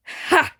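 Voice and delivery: sudden sharp laugh; short laugh. Acted. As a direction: bark out a quick laugh. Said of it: "Mama laughed".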